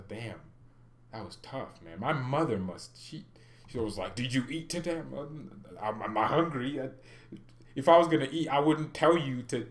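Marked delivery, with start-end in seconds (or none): none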